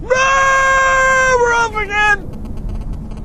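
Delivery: very high, drawn-out whooping cry; thrilled shout. Real, not acted. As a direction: yell it as the car takes off. Car takes off again.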